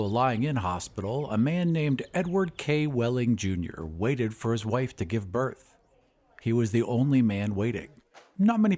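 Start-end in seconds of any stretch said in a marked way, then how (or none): none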